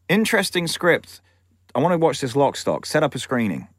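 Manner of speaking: a hint of the actor's voice; Sean Connery. Star phones producer about his script, lightly imitated. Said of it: "I'm not gonna do my bad Sean Connery accent".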